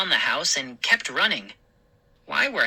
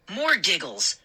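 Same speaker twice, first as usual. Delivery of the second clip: usual speaking voice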